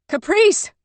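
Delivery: high, loud call across the ice; desperate calling shout; shouting a name. A narrator's reading. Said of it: "she shouted".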